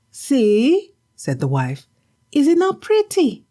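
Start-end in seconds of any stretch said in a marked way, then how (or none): 0.1-0.9 high, nagging wife's voice; a shrewish wife, higher and sharp; scolding wife. Acted. Wife scolding her husband for an empty catch. 2.3-3.4 high, nagging wife's voice; a shrewish wife, higher and sharp; scolding wife. Acted. Wife scolding her husband for an empty catch.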